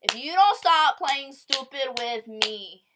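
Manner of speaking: loud, slow, drawn-out mock threat; retold angry warning. Confronting Matt for playing stupid on the phone, angry. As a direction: warn him sternly, stretching the words out.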